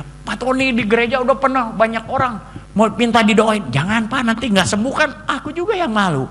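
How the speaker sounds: plead, then refuse; lightly voiced villagers. Church people ask for prayer.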